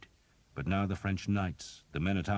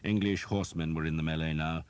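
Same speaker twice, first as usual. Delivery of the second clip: ordinary delivery, nothing marked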